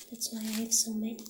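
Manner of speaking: usual speaking voice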